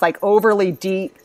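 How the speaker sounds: ordinary delivery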